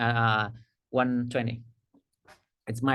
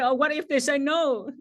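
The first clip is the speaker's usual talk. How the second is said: mock worried thought; 9 semitones higher than usual. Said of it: "I'm thinking".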